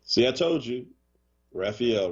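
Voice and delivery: ordinary delivery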